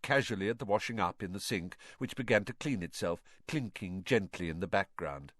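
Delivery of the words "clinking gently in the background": usual speaking voice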